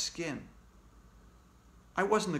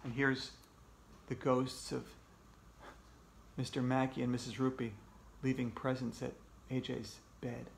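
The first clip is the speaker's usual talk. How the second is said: usual speaking voice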